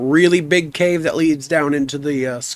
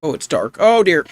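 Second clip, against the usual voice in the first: ordinary talk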